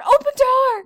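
put-on high, loud toddler demand. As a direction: insist like a small kid. Toddler voiced.

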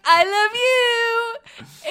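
drawn-out, teary and high, very slow; overcome with emotion. Retold or imitated. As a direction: melt as if about to cry with joy. Mom moved by toddler's words.

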